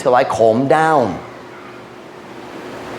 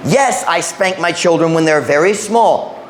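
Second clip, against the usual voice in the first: ordinary delivery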